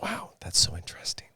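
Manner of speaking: sarcastic whisper; breathy mock-amazed whisper. Mock reaction to comments.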